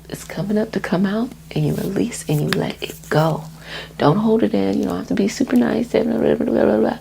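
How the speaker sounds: ordinary talk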